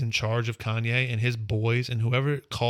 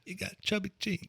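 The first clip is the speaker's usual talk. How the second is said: put-on baby talk; 7 semitones higher, 10 dB quieter than usual. Teasing about chubby cheeks.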